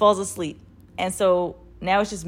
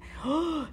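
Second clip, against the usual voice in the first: sudden high intake of breath; sharp gasp; 10 semitones higher, 5 dB quieter than usual. Retold or imitated. Reliving waking up scared in the tent, sharp gasp. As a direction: gasp in acted panic.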